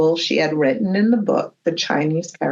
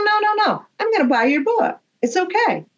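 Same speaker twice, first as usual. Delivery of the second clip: eager reassurance; 11 semitones higher, much faster than usual. Retold or imitated. Reassuring the apologizing author.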